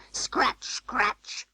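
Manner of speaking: high, soft, scratchy imitation of claws; scratching cat. A narrator's reading. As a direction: make the word sound like scrabbling claws. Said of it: "scuffled Simpkin on the window sill".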